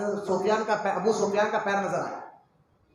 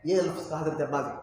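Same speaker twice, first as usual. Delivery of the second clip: normal talking voice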